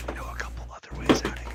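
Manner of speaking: quick, hushed whisper; Squidly whispering. Acted. Kid offering the party another way out, quick whisper. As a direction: whisper it fast and secretively. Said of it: "Squidly whispers".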